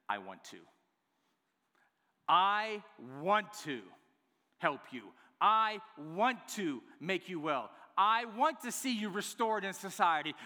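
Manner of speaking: swelling, heartfelt insistence; deeply moved. Acted. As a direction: preach it with mounting fervor, pressing each "I want". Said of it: "deeply moved".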